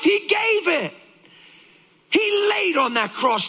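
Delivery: high, insistent pulpit voice; fervent, high-pitched sermon; impassioned preaching. Real, not acted. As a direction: preach it with rising urgency.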